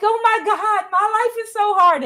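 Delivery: high, whiny hammed-up complaint; mock whiny young person. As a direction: parody a self-pitying video. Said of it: "these videos with these young people like".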